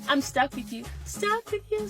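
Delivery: ordinary talk, nothing marked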